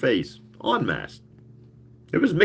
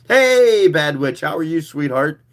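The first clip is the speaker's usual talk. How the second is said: excited greeting; 7 semitones higher, 5 dB louder than usual. Real, not acted. Greets a chat viewer live.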